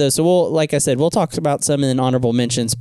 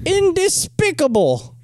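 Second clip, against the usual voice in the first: Vizzini misquote; slower than usual. Retold or imitated. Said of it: "not despicable".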